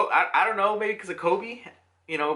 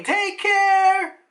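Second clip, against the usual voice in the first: high, loud drawn-out call; yelling farewell; 12 semitones higher, 6 dB louder than usual. Acted. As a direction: call it out after someone leaving. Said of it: "they're all yelling".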